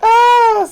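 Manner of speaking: high, loud laughing cry out. Real, not acted. Host overcome by Sutton's comeback, high laughing cry.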